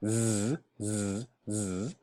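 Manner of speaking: short repeated buzzing "z"; voiced Z sound. Shown as a demonstration. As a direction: buzz "z" as a sound, not a letter name. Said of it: "put your hand here and say z".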